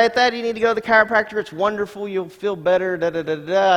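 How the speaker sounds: fast, light mock of eager friends; lightly voiced eager friends. Friends urging the preacher to a chiropractor, fast and breezy. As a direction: rattle it off like pushy friends. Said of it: "all these people say".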